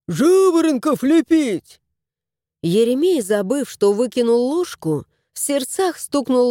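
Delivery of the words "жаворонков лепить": exclaiming house spirit. A narrator's reading.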